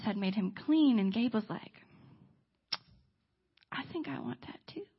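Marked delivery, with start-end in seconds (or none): 3.7-4.9 shy admission. Retold or imitated. Gabe admitting to Ray he wants it, shy and hushed. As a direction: confess it almost in a whisper. Gabe's shy retold confession.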